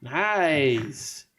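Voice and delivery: long drawn-out catchphrase impression; Borat. Podcaster reacting to co-host's picture, drawn-out comic impression. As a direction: stretch the single word out in accented delight. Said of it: "uh, Borat".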